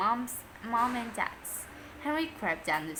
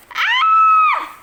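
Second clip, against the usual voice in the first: very high, very loud scream; screaming in fright; 17 dB louder than usual. A narrator's reading. Henry landing on a lump in the dark, screaming. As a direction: shriek at the top of your lungs. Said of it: "screamed Henry".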